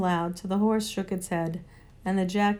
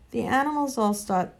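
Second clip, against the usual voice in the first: ordinary delivery, nothing marked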